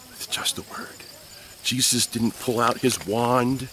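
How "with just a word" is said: soft breathy whisper of the words; whispered echo. Shown as a demonstration. Preacher whispering to the children for awe. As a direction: hush it to let it sink in. Whispered repeat of Jesus' power.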